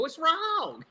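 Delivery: innocent surprise. Retold or imitated. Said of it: "I go".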